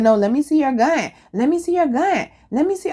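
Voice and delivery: high, pushy mock voice; lightly voiced nagging coworker. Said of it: "She said".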